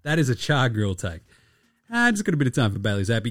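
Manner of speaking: ordinary talk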